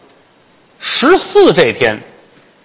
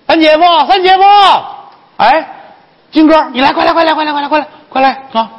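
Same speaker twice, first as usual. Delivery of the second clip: high, excited summons; rapid, insistent high calls; urgent calling; faster than usual. Acted. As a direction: call him over in a hurry, pitched way up. Urgently calling Jin over.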